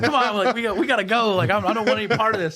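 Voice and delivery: high-pitched anxious begging; mock pleading. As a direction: imitate someone desperate to get out of it. Cellmate begging to give up.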